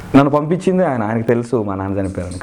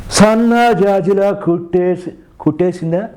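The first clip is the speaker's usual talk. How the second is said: high, slow film-star impression; raised, drawling mimicry; Nagarjuna; 8 semitones higher, 3 dB louder, slower than usual. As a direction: stretch the lyric out in a put-on star voice. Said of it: "అనగా నాగార్జున గారు".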